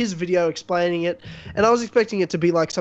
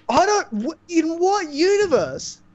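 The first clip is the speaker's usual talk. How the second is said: incredulous; 9 semitones higher, slower than usual. Real, not acted. Disbelief at boyfriend's excuse.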